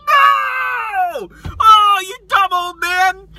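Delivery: high, loud mock-crying shout; crying out like a devastated fan; anguished wail. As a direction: wail it in hammed-up horror. Reliving his horror at Han's death.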